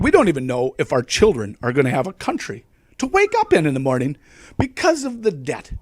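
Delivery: normal talking voice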